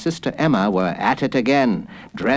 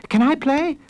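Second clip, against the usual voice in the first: put-on high child voice; high-pitched kid asking; eager little boy. A narrator's reading. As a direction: plead to join in as a small boy. Small boy begs to join.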